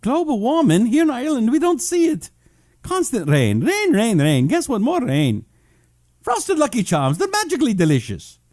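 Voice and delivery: high-pitched, hammed-up Irish accent; terrible Irish accent. Host reading James's comment from Ireland, terrible Irish accent. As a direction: do a badly faked, lilting Irish brogue. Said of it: "that was a terrible Irish accent".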